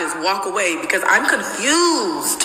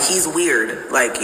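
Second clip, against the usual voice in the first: ordinary talk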